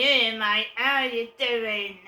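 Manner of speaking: loud, drawn-out Cockney greeting; slow, booming Cockney demo; Cockney accent. Shown as a demonstration. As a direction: call it out in broad common London Cockney.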